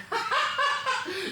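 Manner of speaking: high mocking laugh in a child's face; mocking high cackle. Stepmom mocking the child, high cackle. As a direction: cackle down at a kid. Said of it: "she started laughing in my face".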